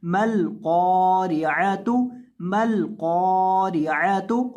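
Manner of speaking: कॉरियातु with qaf held one alif long and thick, thin ra; long heavy qaf. Shown as a demonstration. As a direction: stretch the कॉ, ain from the throat. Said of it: "कॉफ को आपने लंबा करना है".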